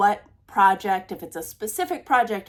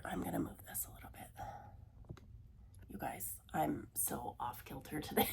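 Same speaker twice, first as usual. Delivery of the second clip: quick, whispered apology; whispered aside; 6 semitones lower, 20 dB quieter than usual. Real, not acted. Apologizing to her viewers in a whisper while adjusting. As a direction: drop to a whisper, a bit flustered.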